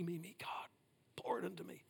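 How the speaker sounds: unvoiced whisper, hushed and pleading; whispered mock plea. Preacher imitating a one-way selfish prayer in a whisper. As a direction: mimic a self-centred prayer.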